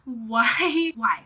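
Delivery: loud, exasperated why with a laugh. Retold or imitated. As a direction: throw up your hands at your past self. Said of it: "I'm like".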